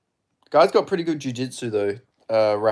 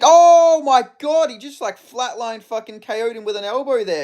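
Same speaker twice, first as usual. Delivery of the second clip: astonished outburst; 10 semitones higher, 6 dB louder than usual. Real, not acted. Streamer reacting to an elbow knockout, high excited cry. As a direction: burst out in disbelief at the hit.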